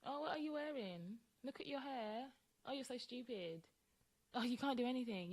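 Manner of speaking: snide nagging partner mocking her looks; mocking insulting partner. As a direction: mimic a belittling partner. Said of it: "They just wanna".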